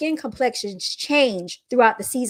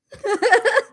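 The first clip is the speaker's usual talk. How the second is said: mocking laugh; 14 semitones higher, 4 dB louder than usual. Real, not acted. Laughing after shut up.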